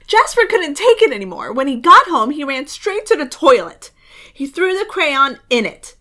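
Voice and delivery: ordinary delivery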